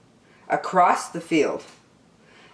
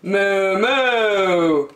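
long, drawn-out cow moo; playful cow impersonation, held out; cow's moo; 3 semitones higher, 4 dB louder than usual. Acted. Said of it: "said the cow".

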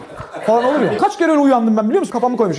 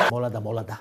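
much lower, softer hint; hushed warning; 12 dB quieter than usual. Retold or imitated. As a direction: tip him off under your breath. Şoför dikizden işaret ediyor. (alçak sesle gizli uyarı)

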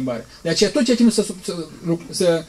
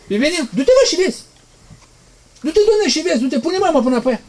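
much higher, louder sneering "go and see"; mocking taunt; 10 semitones higher, 4 dB louder than usual. Preacher imitates the mourners laughing at Jesus, high jeering taunt. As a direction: toss it off like a jeering crowd.

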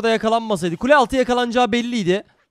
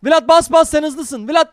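higher, louder chant of commands; urgent urging; 7 semitones higher, 6 dB louder, slower than usual. Real, not acted. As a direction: spur a teammate on frantically. Urging teammate to engage. (heyecanlı, ısrarlı bağırış)

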